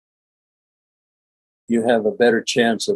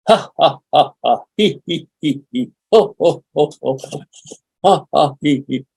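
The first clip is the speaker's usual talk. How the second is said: higher, rhythmic fake laughter; forced practice laugh; 4 semitones higher than usual. Shown as a demonstration. Showing the laughing workshop exercise, deliberate pretend laughter with snorts. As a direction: run through mock ha, hee and ho laughs. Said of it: "We're going to practice laughing".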